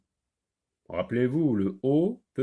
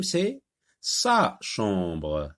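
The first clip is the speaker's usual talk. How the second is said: « sa » very slow and stressed; 2 semitones lower, much slower than usual. Shown as a demonstration. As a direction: stretch out « sa chambre ». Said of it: "c'est la chambre de Karim". (« sa » très lent et appuyé)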